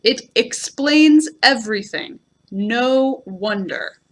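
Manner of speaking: every word punched, No wonder very slow; all in caps. A narrator's reading. Eeyore to Pooh, in capital letters, hammered and slow. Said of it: "That's all in caps".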